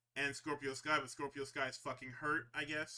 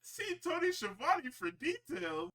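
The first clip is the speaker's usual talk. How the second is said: mock announcer read; 13 semitones higher, 3 dB louder, slower than usual.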